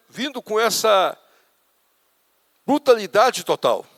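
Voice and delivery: loud, high climactic sermon storytelling; preaching at full force. Acted. Pastor to congregation, retelling the crash, loud high preaching.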